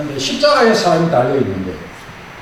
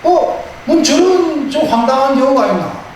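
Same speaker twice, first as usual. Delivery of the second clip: puzzled surprise; 7 semitones higher than usual. Acted. Booth puzzled in dream.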